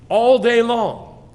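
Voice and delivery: loud, high, drawn-out proclamation; emphatic cry. Shown as a demonstration. Preacher hammering a verse home to the congregation, emphatic cry. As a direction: belt it out slowly.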